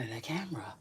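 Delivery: fast whispered repeat. Shown as a demonstration. To her viewers, a quick mock whisper. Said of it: "I said".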